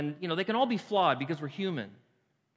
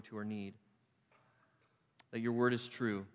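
subdued, measured praying voice with pauses; heartfelt prayer; 3 semitones lower, 3 dB quieter than usual. Real, not acted. Pastor thanking God for grace, quiet congregational prayer. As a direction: pray sincerely, settling down from preaching.

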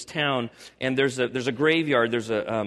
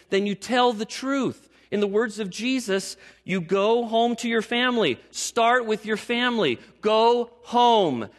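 preaching at full force; 8 semitones higher than usual. Acted. Pastor urging the congregation to go home and tell: fervent preaching. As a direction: drive the charge home to the church.

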